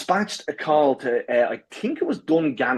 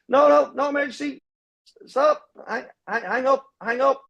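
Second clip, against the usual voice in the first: high, flustered pleading; panicked protest; 11 semitones higher than usual. Retold or imitated. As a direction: frantically wave off the call, higher. Panicked runner begging phone.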